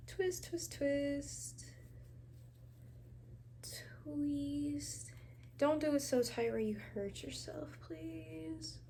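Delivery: soft whispered caution; whispering. Real, not acted. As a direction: whisper a gentle warning. Whispered care advice while twisting.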